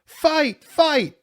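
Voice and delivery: high, loud shout, word by word; yell. A narrator's reading. Onlooker gathering boys round a fight, yelling. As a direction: holler it to draw a crowd. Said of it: "Somebody yelled".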